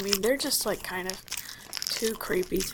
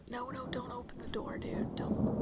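urgent hushed whisper; 3 semitones higher, 13 dB quieter than usual. Acted. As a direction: whisper a frantic warning. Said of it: "I'm gonna whisper".

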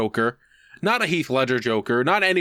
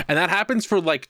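ordinary delivery

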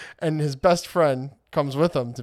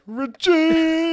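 much higher, louder cheer of "Richie!"; 7 dB louder than usual. Retold or imitated. The fan cheering for Richie on screen, loud cheer. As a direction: shout his name in delight. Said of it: "I legit was like".

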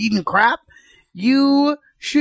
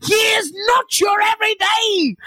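high, slow theatrical declamation; Raul Julia; 12 semitones higher, slower than usual. Acted. Comic showing off his impersonation mid-argument, grand Raul Julia voice. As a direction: cry it out grandly, each word drawn out. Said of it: "I have a solid nine".

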